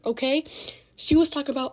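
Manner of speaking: ordinary delivery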